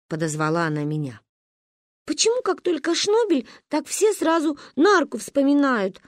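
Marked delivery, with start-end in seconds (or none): 2.1-6.0 put-on much higher, hurt child voice; complain, hurt and indignant; offended girl. Narine protests to grandma, high and offended. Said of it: "обиделась я".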